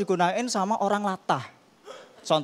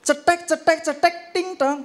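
high clicks ending in a ding-dong; doorbell-like typewriter; 8 semitones higher than usual. Pretend. Comedian imagining a typewriter chiming like a doorbell, playful clicks. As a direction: make it sound like a doorbell.

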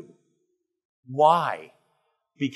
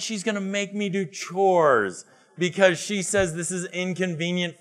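put-on higher, whining teenage plea; pleading teen son; 5 semitones higher, 4 dB louder than usual. Son begging dad to leave work and bring homework, whining. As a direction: beg like a kid dodging chores.

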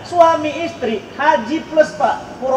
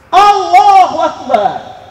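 slow loud exclamation; 5 semitones higher, 7 dB louder, much slower than usual. Real, not acted. Preacher exclaims at tragedy.

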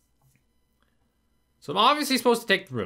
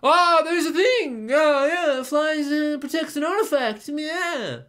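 much higher, slow, sneering mock voice; high sarcastic imitation; mocking the hint; 9 semitones higher, 4 dB louder, much slower than usual. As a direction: parody the game's useless hint. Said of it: "other than fucking".

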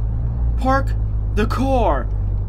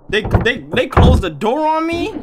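frightened whine; 6 semitones higher, slower than usual. Real, not acted. Gamer locked out and scared of Gerald, whining. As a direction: plead in real fright.

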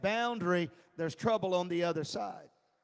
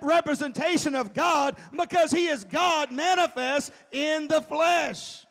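loud, higher, slow preaching shout; full-force preaching; 8 semitones higher, 9 dB louder than usual. Acted. Preacher to congregation, hammering it home loud and slow. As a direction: proclaim it to the back row.

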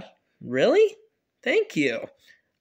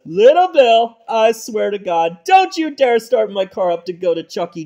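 angry warning; 8 dB louder than usual. Acted. Dad warns son.

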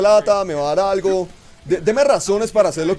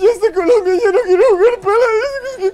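hammed-up sobbing, very high and louder; mock crying; 8 dB louder than usual. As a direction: wail like a whiny fan in mock tears. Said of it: "Van a empezar a llorar, a decir".

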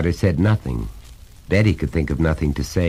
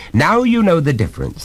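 higher, louder, hammering each point; stern father, pitched up and insistent; exasperated lecturing father; 13 semitones higher, 5 dB louder than usual. Acted. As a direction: lay down the law to a small son.

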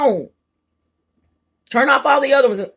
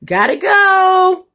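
sing-song sign-off; 8 semitones higher, 4 dB louder, much slower than usual. Pretend. Sign-off catchphrase, stretched and high.

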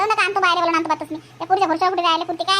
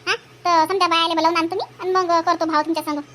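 ordinary talk, nothing marked

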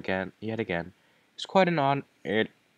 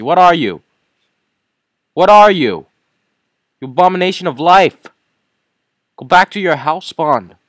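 loud, higher yells at the monsters; yelling; 11 semitones higher, 12 dB louder than usual. Real, not acted. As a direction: shout them down in disgust.

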